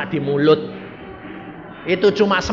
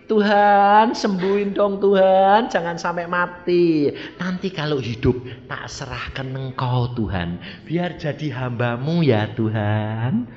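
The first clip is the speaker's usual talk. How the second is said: pleading parent; 3 semitones lower, 6 dB quieter than usual. Acted. Parent praying for a sick child's healing, hushed pleading. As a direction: beg softly, stretching the ends of phrases. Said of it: "Anak sakit".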